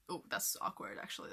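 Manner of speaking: whispered aside; whispered awkward aside; whispering. Real, not acted. Quiet aside after accent slip.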